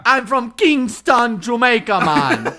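mock Jamaican accent. Shown as a demonstration. Friend copying the given line, loud hammed-up Jamaican accent. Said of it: "Say this, say this".

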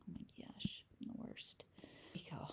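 self-mocking whisper and breathy laugh; embarrassed whisper after a spelling slip; whispered aside. Real, not acted. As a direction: whisper it to yourself, then giggle.

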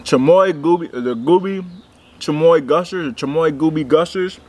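chewing gummy candy; talking while chewing. Real, not acted. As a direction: mouth partly full. Seen 0: Put candy in mouth at -4; pursed, working lips while talking.

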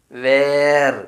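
വേർ said loud with no h sound; loud demo of വേർ without the h; silent h. Shown as a demonstration. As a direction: stress വേർ firmly, h dropped. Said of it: "എച്ച് silent അല്ലേ".

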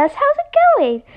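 usual speaking voice

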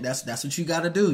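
ordinary talk

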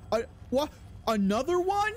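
very high, incredulous question; shocked disbelief. Real, not acted. Player spots another enemy, shocked. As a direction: squeal it upward, appalled.

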